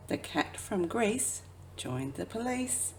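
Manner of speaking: ordinary delivery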